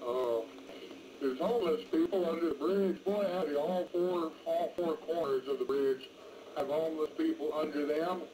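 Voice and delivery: usual speaking voice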